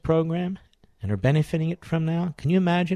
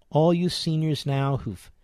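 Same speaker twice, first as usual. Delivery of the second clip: usual speaking voice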